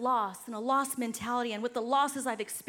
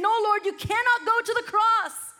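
protesting Peter; 9 semitones higher, 7 dB louder than usual. Retold or imitated.

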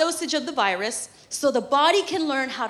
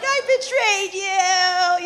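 slow, louder, higher mock guilt; guilty lament; 6 semitones higher, 6 dB louder, much slower than usual. Mom telling her baby after the shot, slow hammed-up guilt. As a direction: drag out the betrayal, hammed up. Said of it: "it's like".

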